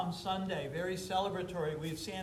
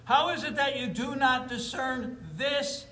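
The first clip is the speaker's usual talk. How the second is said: preaching at full force; 5 semitones higher, 3 dB louder than usual. Acted. Preacher driving his point home.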